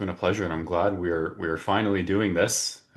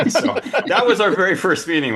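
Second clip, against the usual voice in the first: laughing through the words, higher and louder; 13 semitones higher, 8 dB louder than usual. Real, not acted. Host laughing as he finishes the party story. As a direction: laugh while wrapping up the story.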